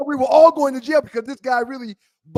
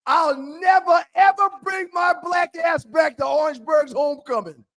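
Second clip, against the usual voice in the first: desperate vow; 6 semitones higher than usual. Retold or imitated. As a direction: swear it to God as if begging for release. Desperate vow to God from jail.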